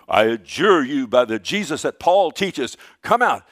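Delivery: loud exorcism command, lifting on the final order; acted-out booming exorcist. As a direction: order the demon out with force. Quoting exorcists commanding a demon.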